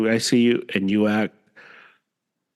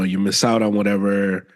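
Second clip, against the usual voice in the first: ordinary talk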